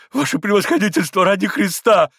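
loud, high, desperate begging; panicked high-pitched appeal; frightened plea. A narrator's reading. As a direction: implore him in alarm. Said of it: "Испуганно остановил его ещё раз".

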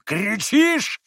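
high, loud angry bellow; angry yell. A narrator's reading. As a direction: bellow at the screaming maid. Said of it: "завопил Митя".

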